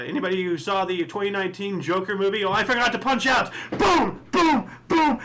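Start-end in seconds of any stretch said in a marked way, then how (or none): 3.7-5.2 high, loud mock gunshots shouted out; gunshot sound effects. Host reenacting the Joker movie scene for viewers, mock gunshots. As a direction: bang out playful gun noises like the film.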